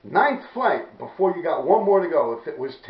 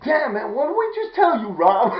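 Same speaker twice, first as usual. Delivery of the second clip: high, loud mock telling-off; worked-up acted reproach; exasperated scolding; 7 semitones higher, 5 dB louder than usual. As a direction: imitate a little friend scolding. Acts out small friend scolding.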